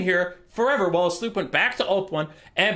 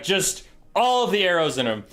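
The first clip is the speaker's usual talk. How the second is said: ordinary talk, nothing marked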